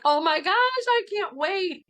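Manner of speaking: high, eager burst of joy; retold giddy excitement at meeting the child; excited squeal. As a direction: gush like you can't wait. Mother voicing her own excitement.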